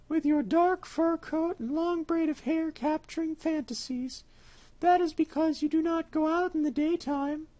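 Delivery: the cat turning it on the mouse; sly cat, chiding. Acted.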